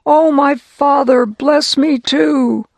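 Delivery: slow, high, loud plea; crying out. Acted. Esau begging his father for a blessing, slow loud cry. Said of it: "he cried out".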